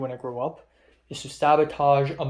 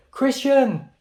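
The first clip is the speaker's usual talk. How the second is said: joking call out; 10 semitones higher, 7 dB louder than usual. Retold or imitated. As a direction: playfully shout his name across the room. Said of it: "And I jokingly was like".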